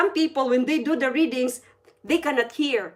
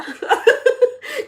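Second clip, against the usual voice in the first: high, loud giggling burst; real laugh; 12 semitones higher, 6 dB louder than usual. Real, not acted. Laughing at asking to sing in church, amused. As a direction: laugh out loud at your own joke. Laughs at her own joke.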